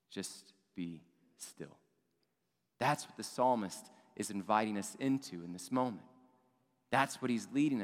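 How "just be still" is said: very soft and slow, almost breathed; hushed stillness. Retold or imitated. Psalmist calming the people, very soft and slow. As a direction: let the words settle, slow and hushed.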